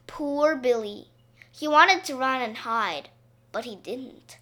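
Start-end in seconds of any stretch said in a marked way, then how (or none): none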